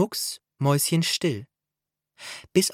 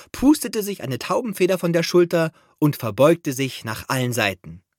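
ordinary delivery

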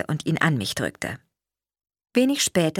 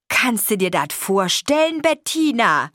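proud father; 6 semitones higher, 4 dB louder than usual. A narrator's reading. Proud father nudges his wife.

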